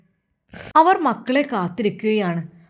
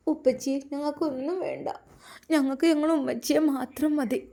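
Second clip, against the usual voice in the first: put-on tearful child; 5 semitones higher than usual. A narrator's reading. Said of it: "ആ മക്കൾ കരയുകയായിരുന്നു".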